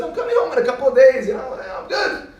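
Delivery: high, quick reassurance; brushing Mom off. Retold or imitated. Camper to mother on the phone, brushing her off. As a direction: rush it out, pitch climbing.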